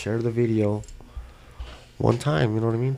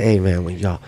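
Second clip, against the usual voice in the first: ordinary delivery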